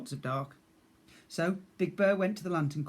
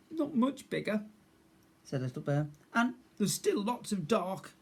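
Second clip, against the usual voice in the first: high small timid voice; small scared bear cub; 6 semitones higher than usual. Acted. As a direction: speak as a frightened little cub.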